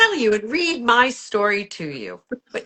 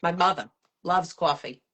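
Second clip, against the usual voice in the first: lower, softer Brooklyn delivery; Brooklyn accent; 5 semitones lower, 6 dB quieter than usual. Shown as a demonstration. Guest repeats her demo line, Brooklyn accent. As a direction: dwell on the Brooklyn vowels. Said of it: "in your Brooklyn accent".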